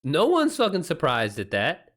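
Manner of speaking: usual speaking voice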